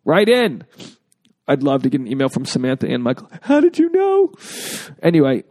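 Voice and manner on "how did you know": high, astonished little squeak; mock amazement. Listener amazed that the host called her name, higher voice. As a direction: play the stunned listener who feels caught out.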